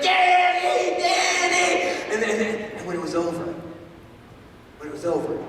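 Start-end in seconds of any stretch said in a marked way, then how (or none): 0.0-2.1 much higher, louder cheering chant; crowd shouting a name. Retold or imitated. Everybody at the dinner cheering Danny, loud high chant. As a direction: roar the name like a whole room. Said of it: "everybody was like".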